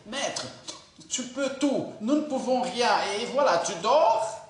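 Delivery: indignant complaint building to a loud tu dors; complaining disciples. Retold or imitated. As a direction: accuse the sleeping master, pitch climbing. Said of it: "Non, c'est".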